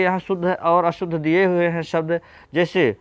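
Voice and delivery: ordinary talk, nothing marked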